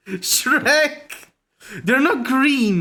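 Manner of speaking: loud and high, excited; excited shout. Real, not acted. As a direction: blurt it out with delight. Shouting realization, laughing.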